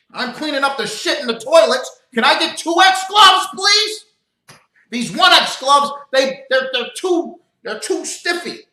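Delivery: high, loud whining complainer voice; whiny Edom. Retold or imitated. Preacher mocking Edom's complaint in a high whine. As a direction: complain like a spoiled worker. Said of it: "Edom might say".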